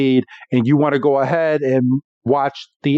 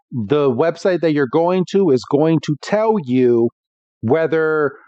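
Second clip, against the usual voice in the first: ordinary talk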